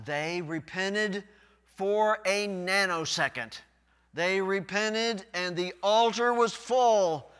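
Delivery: loud, high pulpit preaching; full-force preaching. Real, not acted. Pastor preaching the people's weeping at full force. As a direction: drive it home to the congregation.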